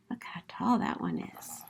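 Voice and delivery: hushed, awed whisper. Acted. Jovi shows Buddy the tallest tree, awed whisper. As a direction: breathe it out in wonder.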